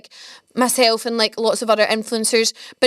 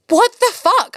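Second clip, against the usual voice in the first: sudden high, louder outburst of disbelief; startled swear; shocked; 10 semitones higher, 4 dB louder, slower than usual. Retold or imitated. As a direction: blurt it out, stunned. Shocked by Callum's creepy suggestion.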